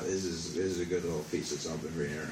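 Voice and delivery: dropped to a low, soft aside; confiding. Acted. Comic to the crowd, sharing a trick in a soft aside. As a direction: lean in and let them in on a secret.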